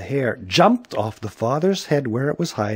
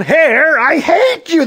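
high, loud angry yell; 7 dB louder, slower than usual. A narrator's reading. Policeman yelling at hair on his face, angry yell. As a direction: yell hatefully at full pitch. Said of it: "So the policeman yelled".

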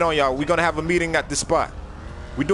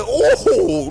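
hype yell; 9 semitones higher, 5 dB louder than usual. Acted. Hyping own bars.

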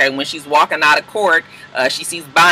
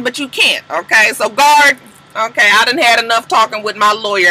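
raised, higher-pitched acted rant; exasperated tirade; 5 semitones higher, 3 dB louder than usual. Jailed client telling her lawyer it's hopeless, acted exasperation. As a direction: play a fed-up client at the end of her rope.

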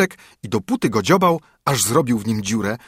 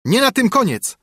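much higher, louder crow of victory; triumphant call; 12 semitones higher, 4 dB louder than usual. A narrator's reading. Sparrow calls out to the ruined carter, high and triumphant. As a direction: crow it out over him. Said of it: "zawołał wróbel".

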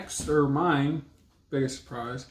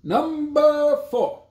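slow, high, loud countdown announce; drawn-out game-show announcement; announcer call; 11 semitones higher, 6 dB louder, much slower than usual. Real, not acted. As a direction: call out the number like a host. Announcing the next category.

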